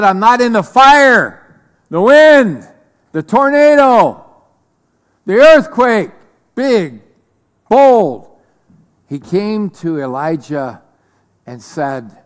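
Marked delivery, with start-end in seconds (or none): 0.0-8.3 loud, high and powerful, like a mighty storm; booming God voice. Acted. Preacher voicing God in the storm, booming. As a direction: proclaim it big and bold.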